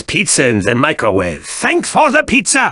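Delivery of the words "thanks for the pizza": squeaky high thanks. Acted. Customer thanks Scout cheerfully.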